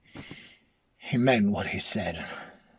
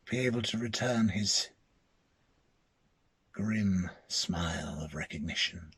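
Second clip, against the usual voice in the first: ominous hush; 4 semitones lower, 4 dB quieter than usual. A narrator's reading. Dread ending of ghost story.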